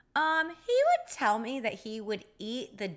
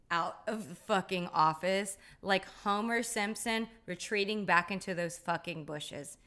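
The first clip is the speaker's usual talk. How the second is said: ordinary talk, nothing marked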